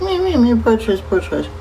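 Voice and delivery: high, whiny mock self-pity. Mocking her own self-pity, high and whiny. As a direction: whine it like a spoiled brat. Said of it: "I'm like".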